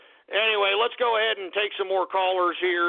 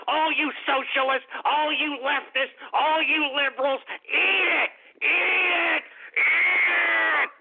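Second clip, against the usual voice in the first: taunting shout; 8 semitones higher than usual. Real, not acted. Host taunting opponents, rising shout.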